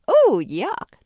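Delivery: loud, high mock disgust. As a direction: exaggerate the revulsion, pitch swooping. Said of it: "make a disgusted face".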